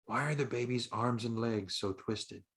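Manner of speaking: put-on girl leaning in to her friend, quietly asking; quiet question. A narrator's reading. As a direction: ask it softly so the grown-ups don't hear. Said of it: "Rebecca quietly asks".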